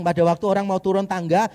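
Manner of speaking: normal talking voice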